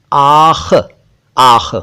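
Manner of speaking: आख with a throaty Urdu ख at the end; careful kh sound. Shown as a demonstration. Teacher drilling the Urdu kh sound. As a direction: repeat आख, stressing the final ख. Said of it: "ये खे है".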